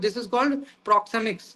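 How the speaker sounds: very slow, leaning on "called"; dictating the term slowly; slow reveal. Shown as a demonstration. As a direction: spell out "this is called proxemics" word by word, "called" stretched.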